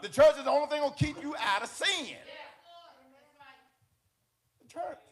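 high, loud preaching shout; full-force preaching. Real, not acted. Preacher exhorting brothers and sisters about the church, shouting fervently. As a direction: preach it at the top of your voice, pitch held high.

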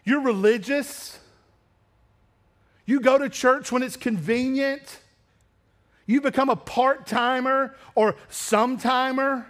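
a preacher driving the point home; fiery preaching. Acted. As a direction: hammer each charge from the pulpit. Preaching at full force.

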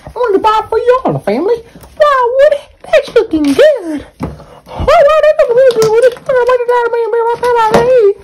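put-on high-pitched doting girlfriend voice; squeaky sweet-talking partner. High character voice admires food.

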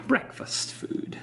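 breathy whispered aside. Pretend. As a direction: whisper it like a secret. Teasing the breakfast meal.

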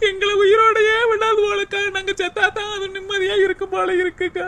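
high, sobbing wail; tearful lament held on a high, even note; crying. Acted. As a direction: wail despairingly through the tears. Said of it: "நீ ஏண்டி அழுதுகிட்டு".

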